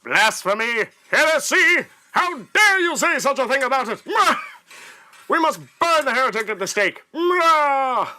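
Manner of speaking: high shouting zealot crying heresy; angry mob leader. Acted. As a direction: rant like a furious crusader. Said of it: "grab your pitchforks, we're going on a crusade".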